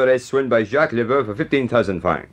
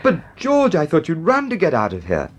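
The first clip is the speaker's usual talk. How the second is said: high-pitched, incredulous protest; astonished; 6 semitones higher than usual. Acted. Friend baffled that freed prisoner lingers, astonished. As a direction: question him in bafflement.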